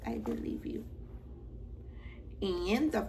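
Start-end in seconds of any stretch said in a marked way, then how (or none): 0.1-0.8 "I believe you" whispered, voiceless and soft. Shown as a demonstration. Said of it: "I believe you (repeated)".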